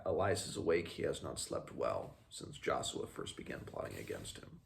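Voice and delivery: ordinary delivery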